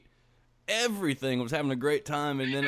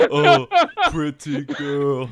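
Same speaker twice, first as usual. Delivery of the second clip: laughing; 11 dB louder, much slower than usual. Real, not acted. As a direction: giggle through it, slow. Laughing mock swoon.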